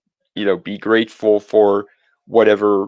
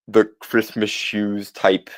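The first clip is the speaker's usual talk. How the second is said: ordinary talk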